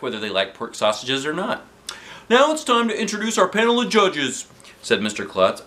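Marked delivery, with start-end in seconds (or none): 2.3-4.5 high, bright showman's welcome; announcer voice. Acted. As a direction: announce it to the crowd. Principal announcing on stage.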